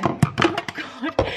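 usual speaking voice

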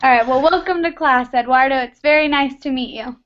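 ordinary talk

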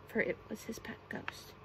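plain reading voice

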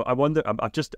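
ordinary talk, nothing marked

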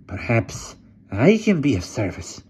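slow, creepy hunchback servant; hunchback assistant. A narrator's reading. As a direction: speak like a sly old hunchback.

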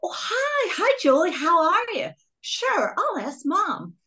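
put-on high, chirpy American accent on the phone; an English girl doing American for her friend Julie. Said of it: "talking to my mother in my English accent".

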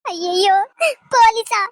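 sharp high frightened "போலீஸா?"; frightened disbelief at the word police; shocked gasp. Acted. Grandmother hears police threat.

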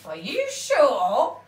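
a troll half tempted; doubtful troll. A narrator's reading. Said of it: "said the troll".

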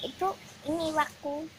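low, quiet puppet voice; goofy muffled sock puppet. Pretend. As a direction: talk low and soft like a hand puppet. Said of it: "pakai boneka tangan".

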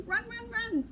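normal talking voice

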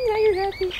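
high, loud gushing at the dog; excited happy. Pretend. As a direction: cheer the dog up with playful glee. Gleeful repeat cheering up dog.

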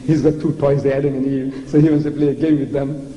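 normal talking voice

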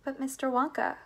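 tentative calling out; calling after someone. A narrator's reading. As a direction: call after him to stop him. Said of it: "Grandpa Joe called after him".

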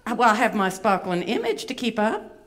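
fussy, vain Southern belle puppet; Miss Bevy. Acted. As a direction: complain in a lilting Atlanta accent. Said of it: "she does have a bit of an accent".

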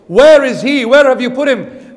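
loud, high, insistent demand; challenging question. Acted. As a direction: fire the question at the crowd. Preacher voices Jesus' pointed question.

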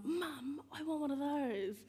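put-on a small child wanting a toy; little kid. Child tells mum it wants a wheelchair, high childlike plea.

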